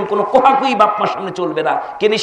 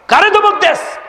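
high, forceful challenge; angry rebuke; 14 semitones higher, 4 dB louder than usual. Acted. Preacher voicing Allah's anger at a scolding son, angry. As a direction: demand an answer in acted wrath. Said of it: "আর এখন আমার আল্লাহ রাগ করে".

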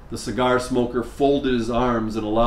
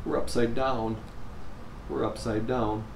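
sobbing quietly; 8 dB quieter than usual. Acted. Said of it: "Jennifer sobbed".

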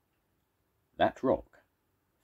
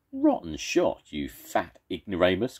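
jeering call; 3 semitones higher, 4 dB louder than usual. Acted. First monster jeers at the second after a miss. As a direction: taunt the missed throw loudly. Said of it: "called the first monster".

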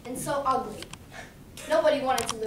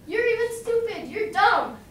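mocking taunt; 7 semitones higher, 4 dB louder than usual. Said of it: "started making fun of the taniwa".

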